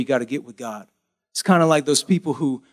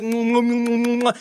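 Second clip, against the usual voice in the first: smother him with big noisy kisses; smacking kisses; 6 semitones higher than usual. Retold or imitated. Father profusely kissing his returning son, smacking kisses. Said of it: "profusely kissing him on the neck".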